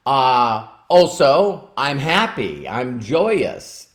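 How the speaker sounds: each feeling drawn out slowly; slow listed feelings. Retold or imitated. Quoting followers' moods, slow and drawn out. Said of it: "they'll say".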